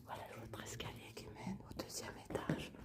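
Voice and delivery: soft unvoiced whisper throughout; library whisper. Real, not acted. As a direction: keep it hushed, exploring in the dark. Whispering while exploring the house.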